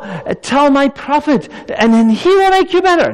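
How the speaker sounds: high plea building to a loud, ringing cry; impassioned little maid's appeal, swelling to a shout; earnest urging. Acted. Acting the maid pleading with Naaman.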